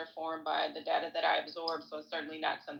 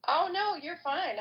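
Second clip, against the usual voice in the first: reassuring; 11 semitones higher, slower than usual. Real, not acted. Reassuring interrupted co-host.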